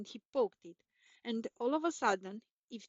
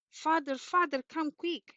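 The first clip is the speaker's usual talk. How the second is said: higher, louder frightened call; alarmed cry; 6 semitones higher, 5 dB louder than usual. A narrator's reading. Mother calling the father in alarm, loud high cry. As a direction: call for help in a panic. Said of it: "she cried".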